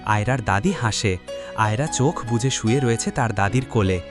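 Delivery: ordinary delivery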